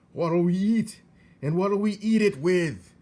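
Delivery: high, loud indignant cry; Uncle's outrage. A narrator's reading. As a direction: howl the question in disbelief.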